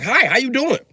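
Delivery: high, chirpy imitation of a girl saying hi; mock friendly girl greeting. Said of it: "walk up to me and be like".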